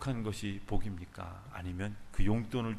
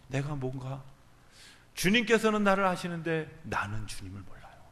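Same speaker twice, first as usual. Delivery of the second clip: usual speaking voice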